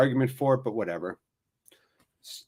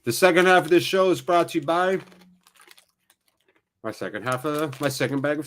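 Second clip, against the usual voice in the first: mock sponsor read; 4 semitones higher than usual. Streamer announcing his snack as sponsor, mock announcer read.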